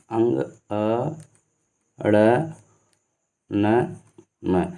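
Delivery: अंग, अ stretched slowly and softly; drawn-out sounds. Shown as a demonstration. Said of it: "जिनको हम कह सकते हैं अंग". (अंग, अ को धीरे-धीरे खींचकर बोलिए)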